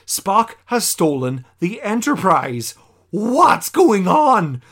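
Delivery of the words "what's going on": slow, loud, high puzzled question; stunned viewer recapping the twist; baffled. Retold or imitated. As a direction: drag it out, bewildered. Baffled at Spock's theft.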